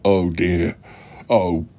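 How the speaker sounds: normal talking voice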